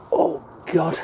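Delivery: winded gasp, pitch up; puffing after getting up off his knees; out of breath. Real, not acted. Gardener winded from standing up, breathless gasp. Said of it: "I'm out of breath just getting up there".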